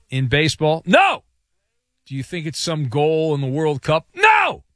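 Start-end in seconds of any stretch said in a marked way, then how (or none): 0.8-1.2 high, loud burst of refusal; emphatic no. Acted. Emphatic answer to own question. 4.1-4.6 very high, loud mock-outraged cry; emphatic no. Emphatic answer to own question.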